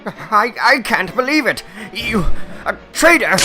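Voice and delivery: high, stammering disbelief; shocked accusation. Acted. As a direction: gasp out the accusation, peaking loud on the last word.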